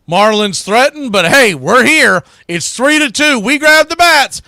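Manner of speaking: rally the fans for the comeback inning; excited rally call. Real, not acted. Radio announcer firing up home listeners before their turn at bat, excited shout.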